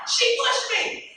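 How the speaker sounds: put-on high little girl's voice; a frightened kid tattling to mom; scared child, high-pitched. Child telling mother.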